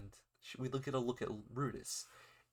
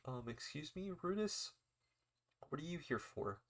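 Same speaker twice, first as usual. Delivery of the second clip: lightly voiced teacher, questioning lift higher on the name; hesitant, softer start, imitating a surprised teacher; puzzled teacher; 5 semitones higher, 3 dB quieter than usual. Teacher questions Rudis at the classroom door, lightly acted puzzlement.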